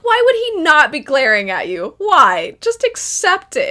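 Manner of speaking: why would he not, loudly; fed-up rant at Zeke; indignant outburst. Retold or imitated. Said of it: "I'm like".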